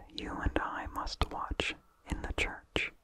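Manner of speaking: ordinary delivery, nothing marked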